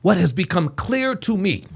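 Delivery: mimicked formal speechmaker, put-on inflections; mocking impression. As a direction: parody his measured, affected delivery. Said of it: "this dude does not talk like this".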